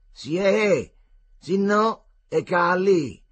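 mocking Roman scoff. A narrator's reading. Zambuia mocking Marcello.

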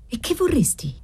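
understated chilly reserve; cold. A narrator's reading. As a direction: ask it coldly, keeping the volume down. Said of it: "disse ella guardandolo freddamente".

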